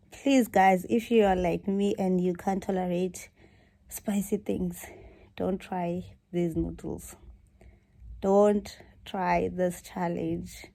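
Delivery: ordinary delivery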